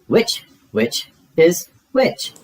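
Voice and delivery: lightly witchy playful line. Acted. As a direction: savor the tongue twister like a witch. Zoe's witch pun.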